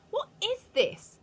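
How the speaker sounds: put-on high, loud, slow boy voice; uneasy boy. A narrator's reading. Bruno on the platform, high and wary. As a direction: demand it like a wary kid. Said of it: "he said".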